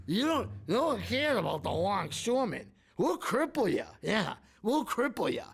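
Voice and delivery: high mock mobster threat; mafia tough guy. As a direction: menace the crowd like a Soprano boss. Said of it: "mafia Tony Soprano impersonation".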